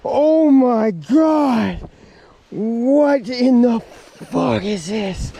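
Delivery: very slow, high, loud exclamations; stunned disbelief. Real, not acted. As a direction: drag each word out in shock. Stunned by a huge fish.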